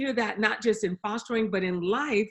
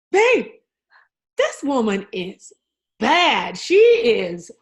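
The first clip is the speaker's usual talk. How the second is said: much higher and louder, gushing; bursting with excitement at the book; excited exclaiming; 4 semitones higher, 7 dB louder, slower than usual. Retold or imitated. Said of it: "I just kept going like this".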